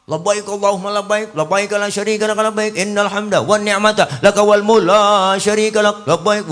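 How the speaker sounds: fast, high talbiah rattled off; fast spirited talbiah. Retold or imitated. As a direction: race through it like an eager pilgrim. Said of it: "talbiahnya cepet banget".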